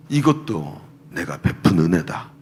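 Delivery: low, slow, solemn divine voice; voice of God. Retold or imitated. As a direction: speak it slowly and low, as God. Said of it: "마음속에 얘기하시는 거예요". (낮고 느린 하나님의 음성)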